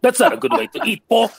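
mock scolding, pitched well up; high-pitched scolding. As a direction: chide Paul in a high playful voice. In-character quip repeated, pitched up.

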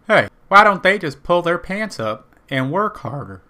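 lightly voiced smug know-it-all; a hint of a preachy uncle; mock bigot. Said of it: "I either look like".

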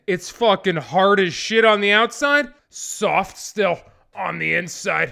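loud, emphatic explanation; insistent inventor. Acted. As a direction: belt out the description, stretching the end.

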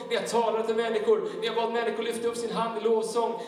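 high-energy, driving testimony from the pulpit; impassioned preaching. Acted. Preacher testifying to the congregation about God's strength, impassioned. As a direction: preach it with urgent, sustained projection.

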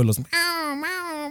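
high imitated cat meowing; cat meows. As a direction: meow like fighting cats, high and thin. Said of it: "y digo". (maullidos agudos imitando a un gato)

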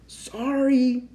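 apologetic Santa. A narrator's reading.

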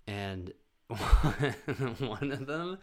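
laughing through a very slow "one of them"; cracking up before the story starts. Real, not acted. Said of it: "my favorite story about my mom".

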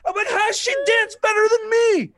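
high, loud laughing disbelief; laughing shout. Real, not acted. Laugh-shouting about baby dancing.

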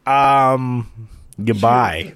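booming from mic proximity; too close to the mic. Real, not acted. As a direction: lips right on the mic. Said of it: "I was too close".

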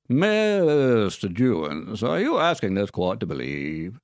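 slow, honeyed Southern courtroom drawl; Southern lawyer accent. Retold or imitated. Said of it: "doing a Southern lawyer accent in a courtroom drama".

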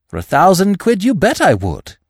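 put-on high, keen young gentleman; emphatic, enthusiastic assent; eager young Freddy. A narrator's reading.

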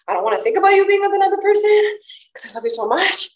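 a hint of his tearful voice; retold boyfriend. Boyfriend telling her to move on, lightly voiced. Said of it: "He's like".

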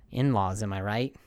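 normal talking voice

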